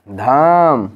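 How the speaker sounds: धाम with a loud, long-held धा; stress on धा. Shown as a demonstration. Teacher demonstrating emphasis to the class, धा stressed in धाम. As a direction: punch and stretch the धा in धाम. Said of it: "धा पर".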